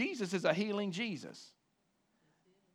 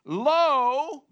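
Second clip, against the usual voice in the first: "Lo" high, loud and stretched out; extreme emphasis; 6 semitones higher, 8 dB louder than usual. Acted. Preacher quoting the New King James, emphatic "Lo". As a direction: proclaim "Lo" as one big raised cry. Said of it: "a word of emphasis".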